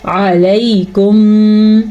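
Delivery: علیکم slow, its ع sounded clearly; علیکم chanted, its ع made clear; clear ain. Shown as a demonstration. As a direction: recite علیکم with a distinct throat ع. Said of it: "آئین کو واضح کر کے پڑھنا ہے".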